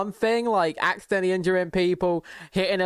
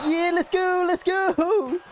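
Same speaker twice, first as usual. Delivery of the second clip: high, hyped-up cheer; pitched way up, whooping with excitement; excited cheer; 11 semitones higher, slower than usual. Real, not acted. Cheering the game cutscene.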